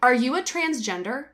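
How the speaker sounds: imitating a stranger's loud question in her ear; retold loud question. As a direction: blurt it out for everyone to hear. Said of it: "talks full volume".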